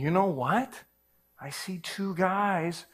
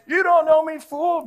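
high, loud mock street tough; imitating a street tough; street tough taunt; 11 semitones higher, 5 dB louder than usual. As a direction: throw the taunt at him. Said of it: "instead of him saying".

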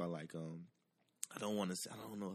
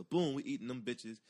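ordinary talk

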